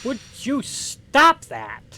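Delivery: high, very slow, loud protest; outraged high wail; shrill slow outrage. Acted. Reacting to in-game character.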